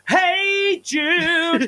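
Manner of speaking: high, loud excited call across the room. Retold or imitated. Bursting through the door, calling out to a friend, loud and high. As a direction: shout a greeting to an old friend. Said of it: "I literally bolted through the door going".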